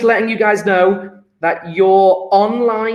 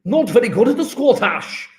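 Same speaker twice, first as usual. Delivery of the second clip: clumsy, swooping Scottish accent; attempted Scottish accent; 3 semitones higher than usual. Shown as a demonstration. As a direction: put on a poor Scottish accent. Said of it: "I can't do a Scottish accent".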